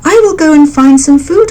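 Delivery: a mother bird promising her chicks food, lightly voiced. A narrator's reading.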